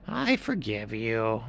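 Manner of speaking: high, slowing heron voice; grudgingly forgiving, pitched high; blue heron. A narrator's reading. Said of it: "And the blue heron said".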